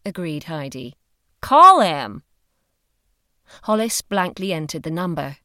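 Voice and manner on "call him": loud, punchy order; forceful and insistent; commanding. A narrator's reading. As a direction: give a firm order at raised volume. Heidi ordering Hollis to phone.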